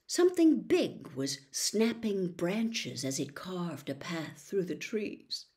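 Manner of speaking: usual speaking voice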